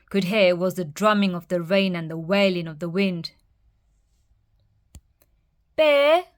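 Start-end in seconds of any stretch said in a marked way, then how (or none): 5.8-6.2 high, louder call for Bear; calling a friend's name from outside. A narrator's reading. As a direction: mouse calls out into the house. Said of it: "Mouse called".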